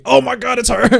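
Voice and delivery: high, startled gasp of recognition; shocked. Real, not acted. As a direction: jolt up in shock. Horror realization.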